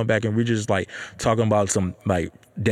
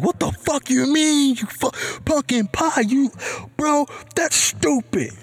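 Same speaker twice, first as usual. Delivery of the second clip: very high-pitched, slow, squealed incredulity; mock disbelief; slower than usual. Viewers scoffing at a pumpkin pie gift, mock disbelief. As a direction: imitate scandalized doubters in falsetto.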